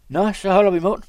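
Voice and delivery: high, loud outburst; loud silencing. A narrator's reading. Ends the quarrel with his companion, loudly.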